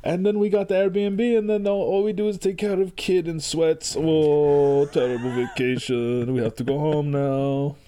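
put-on Quebecois accent. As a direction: ham up a Quebecois tourist complaining. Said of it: "that's my Quebecois accent".

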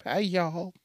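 playful Southern twang greeting; exaggerated twang. Shown as a demonstration. Host showing his little accent to listeners, twangy greeting. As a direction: lay on the country twang. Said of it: "I have a little accent sometimes".

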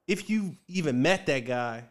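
ordinary talk

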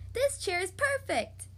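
high, slow, delighted; beaming delight. A narrator's reading. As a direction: savor it slowly with a beaming smile. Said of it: "beamed Goldilocks".

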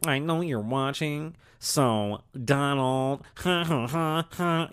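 slow mock politician with a fake chuckle; mocking, leisurely impression ending in forced ha-has; Kamala Harris. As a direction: drag it out, then tack on a phony laugh. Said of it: "I'm making fun of Kamala Harris".

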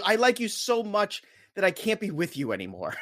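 put-on girl letting you down. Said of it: "when a girl tells you".

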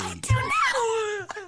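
slow, high, through tears of laughter; laughing. Real, not acted. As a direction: squeak through helpless laughter. Laughing at own blunder.

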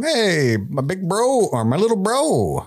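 high, hammed-up buddy greeting; mock chummy brother. Viserys trying to comfort Daemon, hammed-up chummy greeting. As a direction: overdo the brotherly cheer in a high voice. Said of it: "comforts Daemon, or tries to".

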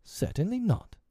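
high, affronted refusal; shocked, high-pitched veto; scandalized. A narrator's reading. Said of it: "Wendy was scandalized".

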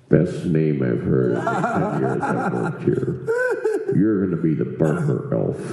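deep, slow, mock-impressed boss. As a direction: drop way down like a gruff manager. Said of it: "And you're like".

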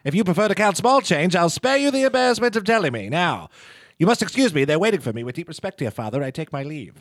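theatrical Mid-Atlantic stage voice; grand old-timey Mid-Atlantic delivery; Mid-Atlantic accent. Acted. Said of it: "you'll be hearing it".

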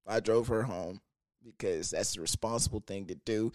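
ordinary talk

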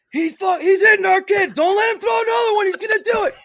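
high, loud, panicked yelling; frantic shout. Retold or imitated. Rival coach begging the umpire, frantic shout. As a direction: shriek it like a coach losing it.

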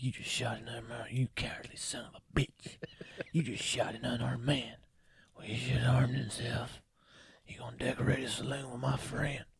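soft, hushed, menacing movie growl; whispered gunfighter threat. Retold or imitated. Quoting Unforgiven tense showdown.

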